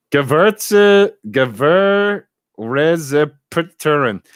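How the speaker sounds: loud, drawn-out mock-German; hammed-up German accent, slowly; funny German accent. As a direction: sound out long words in comic German. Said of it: "the funniest accent ever".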